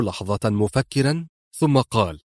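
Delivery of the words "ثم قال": ordinary delivery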